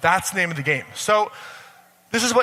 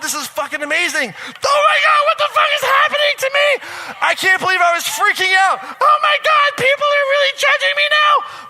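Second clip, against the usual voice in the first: building from excited to wild, much higher, louder shouting; emotional rollercoaster; 11 semitones higher, 5 dB louder than usual. Acted. Someone changing their life swings wildly, higher and louder shouting. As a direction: swing between elation and panic, yelling it out. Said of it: "And it's gonna feel like this".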